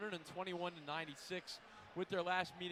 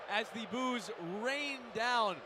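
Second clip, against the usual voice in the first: louder and slower, pushing over booing fans; raised over the crowd; 4 semitones higher, 6 dB louder, much slower than usual. Real, not acted. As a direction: lift the voice over a roaring arena, stretching it out. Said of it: "Could barely even hear myself talk".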